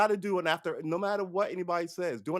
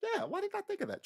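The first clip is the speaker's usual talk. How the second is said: mock surprise; 7 dB quieter than usual. Mock realization, high and soft.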